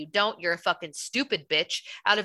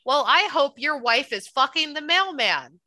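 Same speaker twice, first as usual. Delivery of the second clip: angry; 7 semitones higher, 4 dB louder than usual. Real, not acted. Venting curse at the man.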